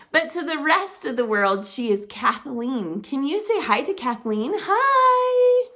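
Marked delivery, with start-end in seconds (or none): 4.5-5.7 cheery hi. Pretend. Greeting mom's photo with kids.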